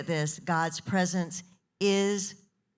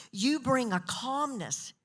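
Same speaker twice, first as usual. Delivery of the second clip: usual speaking voice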